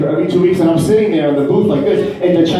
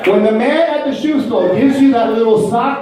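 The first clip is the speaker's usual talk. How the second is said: normal talking voice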